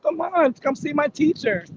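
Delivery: high mocking voice calling him over; mocking the crew. Mocking his crew as they wave him off, high teasing voice. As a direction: pitch it way up like teasing coworkers. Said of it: "talking about".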